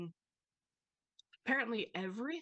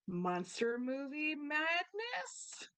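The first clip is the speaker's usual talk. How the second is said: cheesy announcer; 6 semitones higher, much slower than usual. Acted. Dad pitches horror movie night.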